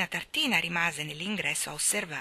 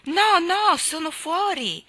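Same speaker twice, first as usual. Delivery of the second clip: slow, much higher voice from behind a door; distant voice; 11 semitones higher, 8 dB louder, slower than usual. Acted. Cousin repeating from inside that he is out. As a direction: insist from far inside. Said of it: "ripeté la voce lontana".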